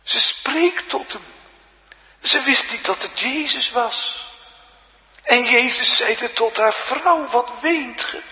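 high, loud pulpit voice; impassioned preaching. Real, not acted. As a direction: proclaim each phrase forcefully, with pauses between. (hoge, bewogen preektoon)

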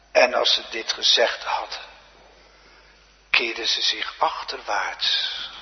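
ordinary delivery

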